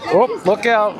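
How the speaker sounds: ordinary talk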